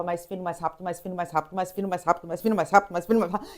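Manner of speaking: ordinary talk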